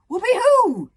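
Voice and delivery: high, loud thrilled whoop. Acted. Hiccup launching off the cliff, whooping. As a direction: whoop with joy.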